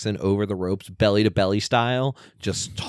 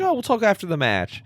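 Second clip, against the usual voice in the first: high, breezy mock brush-off; lightly hammed-up dismissive manager; imitating Don Callis; 11 semitones higher, 3 dB louder than usual. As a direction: toss it off in a put-on high voice. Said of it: "Don Callis like".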